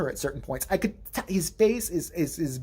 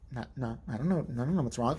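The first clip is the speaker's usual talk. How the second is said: low, soft innocent denial; feigned innocence; 6 semitones lower, 8 dB quieter than usual. Retold or imitated. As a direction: imitate a girl quietly playing innocent. Girl feigning innocence after attack.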